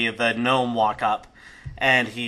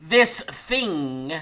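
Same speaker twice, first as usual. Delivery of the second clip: lightly voiced high announcer; gnome showman; 5 semitones higher than usual. Gnome renames the fighter before the crowd, mild showman's voice. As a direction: present it with disdainful ceremony.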